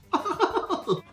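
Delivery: high giggle; peculiar giggle. Acted. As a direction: giggle high like a zany host. Host's character laugh.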